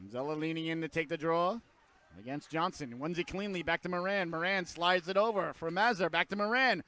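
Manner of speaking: ordinary talk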